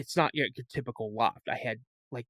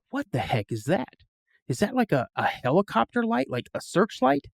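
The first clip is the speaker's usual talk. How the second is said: rising higher and higher in alarm; puzzled alarm climbing up in pitch as the strange light sinks in; retold startled what is that; startled question; 9 semitones higher than usual. Sees strange light in woods.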